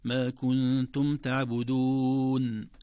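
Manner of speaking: slow, loud, drawn-out teaching recitation; model Quran recitation. Shown as a demonstration. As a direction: recite it slowly with long held vowels. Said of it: "هكذا". (تلاوة تعليمية بطيئة مرتلة)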